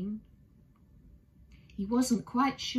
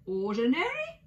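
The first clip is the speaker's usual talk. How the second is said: surprised exclaim; 5 semitones higher, 4 dB louder than usual. Acted. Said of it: "exclaimed Mister Brown".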